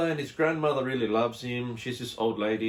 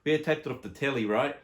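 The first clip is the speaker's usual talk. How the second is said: ordinary talk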